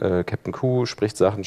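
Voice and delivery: usual speaking voice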